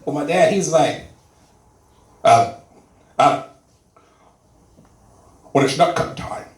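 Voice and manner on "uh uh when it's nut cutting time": mock Sean Connery voice; Sean Connery impression. Dad lecturing his little son in a mock Sean Connery voice. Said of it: "why do you sound like Sean Connery".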